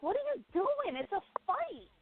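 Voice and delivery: high, incredulous what are you doing; mock yell at the fighter on TV; exasperated disbelief. Host watching the fight, scolding it in disbelief. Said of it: "I'm like".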